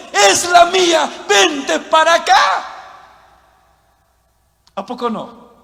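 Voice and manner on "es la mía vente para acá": high, loud, drawn-out hawker's call; mock rival churches calling people over; loud beckoning claim. Preacher imitates every church claiming to be true, loud beckoning.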